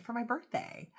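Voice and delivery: usual speaking voice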